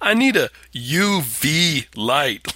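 dragged-out mocking quote; slow, hammy mock of the line.

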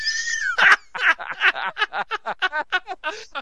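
big whooping laugh; loud high laugh. Real, not acted. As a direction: burst out in a shrieking laugh. Big laugh at own impression.

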